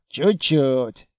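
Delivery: short sullen echo, dropped in pitch; grudging, sarcastic grunt; grumbled. A narrator's reading. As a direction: grumble it back sceptically. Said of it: "буркнул Строгов".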